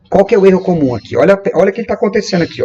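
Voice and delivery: usual speaking voice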